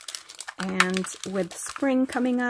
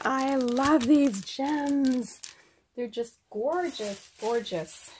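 gushing; 3 semitones higher, slower than usual. Real, not acted. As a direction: savour every word of it. Thanking her friend Sophia.

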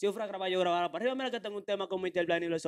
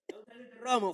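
laughing tease; 4 semitones higher than usual. Acted. Mock teasing the client.

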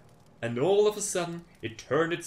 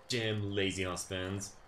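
usual speaking voice

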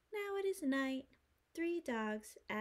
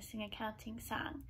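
hushed instructions; 4 dB quieter than usual. Real, not acted. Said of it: "explaining the quack game".

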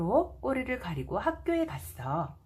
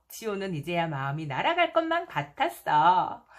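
usual speaking voice

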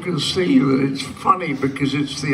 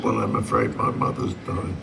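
quiet, breathy, mournful confession; hushed grief; 6 semitones lower, 5 dB quieter than usual. Acted. Son telling the undertaker his mother has died, in a hushed mournful voice. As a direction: break the sad news softly, barely voicing it.

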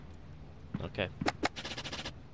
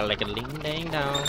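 ordinary talk, nothing marked

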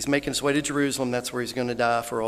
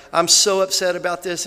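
loud, raised impression of an upset mother; acted upset, loud and higher; Miss Sherry; 5 semitones higher, 11 dB louder than usual. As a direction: imitate her upset outburst. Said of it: "she's like".

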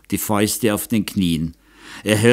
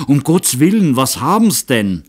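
higher, louder alarmed plea; frightened stammer; 5 semitones higher, 5 dB louder than usual. A narrator's reading. The hunter begs his master to explain, alarmed and higher. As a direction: blurt it out in fright. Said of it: "stotterte Batscheida".

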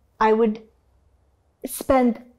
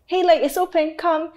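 higher, light imitated welcome; mock inviting; 7 semitones higher, 3 dB louder than usual. Industry people beckoning her to an open door, mock inviting. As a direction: coax them in, pitched up.

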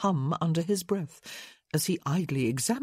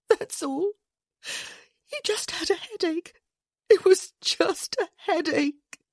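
whimpering; 13 semitones higher, 3 dB louder than usual. A narrator's reading. Grieving wife searching for answers, whimpering in disbelief. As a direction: insist on it through tears. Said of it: "she whimpered".

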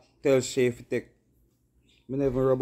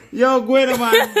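high, loud laughing yell; loud laughing call; excited laughing shout; 11 semitones higher, 10 dB louder, slower than usual. Real, not acted. Laughing exclamation.

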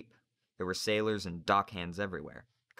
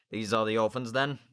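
gruff sailor; 3 semitones higher, 6 dB louder than usual. Acted. Narrator voices Slank.

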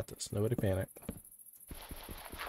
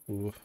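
surprised gasp. Real, not acted. Sudden surprise during game.

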